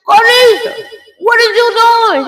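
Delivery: very high, loud mock scolding; loud, high-pitched retold outburst; acted shocked yell. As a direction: re-enact hollering at your daughter in disbelief. Mother yelling at undressing daughter.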